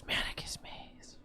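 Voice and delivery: breathy unvoiced whisper of the name. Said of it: "a whisper on the breath of Manicus".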